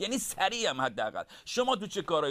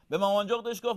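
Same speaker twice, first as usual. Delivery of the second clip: ordinary delivery, nothing marked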